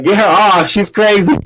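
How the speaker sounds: playfully retold cry for help; imitated kid. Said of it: "the kid was like".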